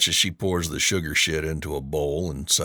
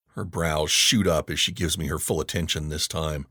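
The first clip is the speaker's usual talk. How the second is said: ordinary delivery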